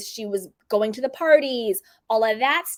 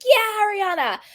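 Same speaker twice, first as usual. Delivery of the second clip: cheering; 10 semitones higher, 3 dB louder than usual. Retold or imitated. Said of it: "everybody was like".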